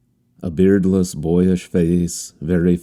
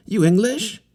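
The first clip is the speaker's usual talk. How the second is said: much higher voice, bright eager question; a grinning youth asking hopefully; all smiles. A narrator's reading. Harlequin asking the captain eagerly, high and smiling. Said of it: "all smiles".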